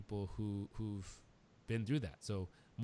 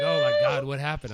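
much higher, much louder startled cry of "oh my God"; alarmed wife; 7 semitones higher, 17 dB louder than usual. Retold or imitated. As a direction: play his wife panicking when he comes out in tears. Wife voiced, alarmed at crying husband.

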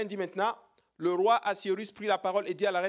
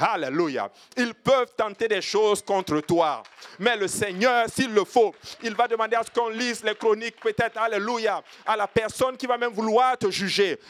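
loud, driving sermon exhortation; preaching at full force; 3 semitones higher, 6 dB louder than usual. Acted. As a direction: rouse the congregation, hammering each line. Preacher exhorts congregation with alleluias.

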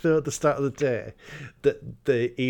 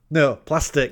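loud, pointed correction on plastic; correcting insistence; 3 semitones higher, 6 dB louder than usual. Retold or imitated. Q correcting Bond's word, firm and loud. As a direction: put him right firmly. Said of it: "As if to go".

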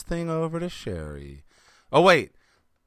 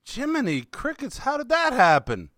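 mock surprise; 11 semitones higher than usual. Real, not acted. Tech failure, mock exclamation.

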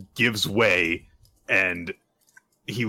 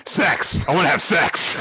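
higher, louder blurt; eager shout; 7 semitones higher, 4 dB louder than usual. Acted. Denji revealing what he lives for, loud eager blurt.

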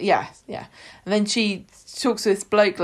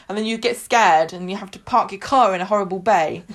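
ordinary talk

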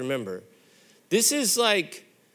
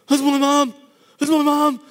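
louder, higher, rising joy; excited; 8 semitones higher, 6 dB louder than usual. Retold or imitated. Boy spotting his mom's car, louder and excited. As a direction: cry out at the sight of his mom. Kid sees mom coming.

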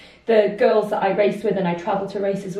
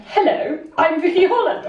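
mock self-introduction; 7 semitones higher than usual. Triathlete imitating her teammate's fake greeting, higher and chirpy. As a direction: play a cheeky teammate passing herself off as Vicky. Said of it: "she was walking around going".

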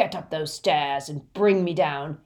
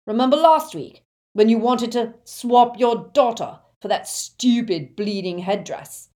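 loud, raised, bullying tirade; rough Death Eater raging; angry roar; 4 semitones higher, 8 dB louder than usual. A narrator's reading. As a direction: berate the victim at full volume. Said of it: "roared the Death Eater".

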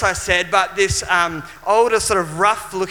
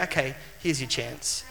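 much softer, gentle inner voice; barely above a breath, like a prompting; still small voice; 5 semitones lower, 11 dB quieter than usual. Retold or imitated. The Holy Spirit prompting the young man, soft still small voice. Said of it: "the Holy Spirit saying".

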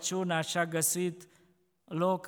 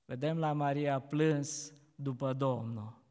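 ordinary talk

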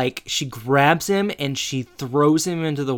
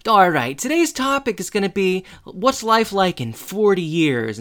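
teacher announcing; 7 semitones higher than usual. Retold or imitated. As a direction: announce the lesson topic like a teacher. Imitating Mister Turner starting class.

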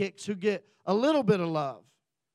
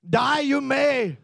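loud, drawn-out battle cry; slow, raised-pitch warrior proclamation; William Wallace; 4 semitones higher, 8 dB louder, slower than usual. Acted. Joshua answers his frightened army, hammed-up William Wallace war cry. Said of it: "Joshua turns into William Wallace".

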